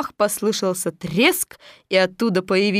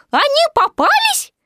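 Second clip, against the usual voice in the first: high, loud worried squeak; anxious Piglet; 5 dB louder than usual. A narrator's reading. Piglet asks Pooh about his friends, anxious. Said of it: "тревожно спросил Пятачок".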